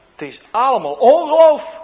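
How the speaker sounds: bursts out loud and high in despair; exasperated outburst. Retold or imitated. Young intern on the market, fed up, cries out loudly. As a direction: throw it out as a cry of giving up.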